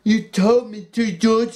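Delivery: high, loud, wounded plea; Lenny defending himself miserably; miserable. A narrator's reading. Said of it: "he said miserably".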